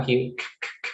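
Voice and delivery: "k" said alone, a short voiceless click; k sound. Shown as a demonstration. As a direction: repeat "k" crisply with no voice. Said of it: "when you make the sound k".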